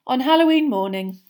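plain reading voice

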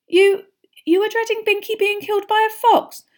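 put-on high, incredulous girl; Hermione voice. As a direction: ask it in disbelief. Hermione disbelieving question.

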